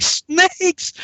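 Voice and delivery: high, alarmed squawk of disbelief; mock freaking out. Recruit hears about snakes, mock panic. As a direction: blurt it high in comic panic. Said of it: "I'm freaking out".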